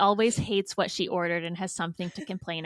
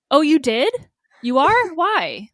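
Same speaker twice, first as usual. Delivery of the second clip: mock the nosy uncle, higher and louder; 7 semitones higher, 9 dB louder, slower than usual. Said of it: "He's like".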